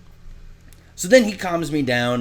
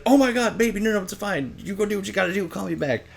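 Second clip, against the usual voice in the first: put-on higher, sweet worried girlfriend; 8 semitones higher, faster than usual. Girlfriend reassuring him on the phone, sweet and worried. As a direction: play the girlfriend, soothing and concerned.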